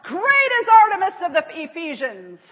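loud, high chant of an angry mob; crowd's shout. A narrator's reading. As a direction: shout it out like a furious crowd, pitch jumping up. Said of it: "they began to shout".

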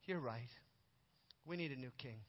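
low, quiet admission; hushed and humbled, with pauses between sentences; subdued. Retold or imitated. Little king bows his head to his advisors, subdued. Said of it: "He bowed his head".

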